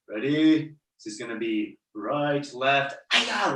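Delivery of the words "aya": high, short martial arts kiai; quick high yell to show the class; demonstrated yell. Shown as a demonstration. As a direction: yelp out a kiai. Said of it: "Loud yell".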